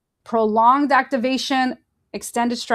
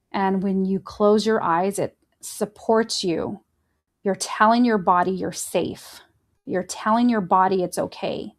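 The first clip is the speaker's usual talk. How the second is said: guided breathing; 2 semitones lower than usual. Acted. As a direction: talk them through each breath.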